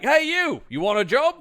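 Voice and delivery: high, loud hail to a stranger; mock calling out. Podcaster acting out a boss hailing a passer-by, mock calling out. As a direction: call out big and high, as a quick impression.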